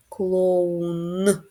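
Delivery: "клоун" drawn out, ending on a clear Н; sounding out. Shown as a demonstration. Teacher sounding out the last sound of clown. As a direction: stretch "клоун" and land on the final Н. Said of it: "І останній".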